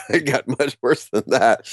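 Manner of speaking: relieved, amused chuckle through the words; real chuckling. Real, not acted. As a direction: laugh while finishing the thought. Laughs at own bad luck.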